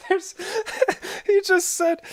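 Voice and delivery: words squeezed out through high laughter; helpless laughter. Real, not acted. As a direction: laugh so hard you can barely talk. Laughing too hard to speak.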